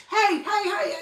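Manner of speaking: hammed-up paparazzi calling for attention.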